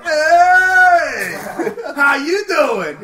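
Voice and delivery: loud laughing shout, dragged slowly on the question; panicked fake-cheery shout. Caught pulling up by his mom, a laughing panicked shout. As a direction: yell a too-bright hello to cover getting caught.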